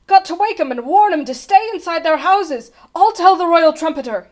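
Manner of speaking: loud, high, determined alarm; urgent shout. A narrator's reading. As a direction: shout it as a rallying warning. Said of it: "he shouted".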